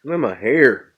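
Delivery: ordinary talk, nothing marked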